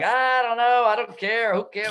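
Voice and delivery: hammed-up weary dismissal; mock exasperation. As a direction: whine it like you can't be bothered. Retold brush-off to chatty driver.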